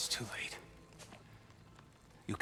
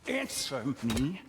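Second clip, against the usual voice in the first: angry demand; 4 semitones higher, 6 dB louder, slower than usual. Acted. Freyr demands about his sister.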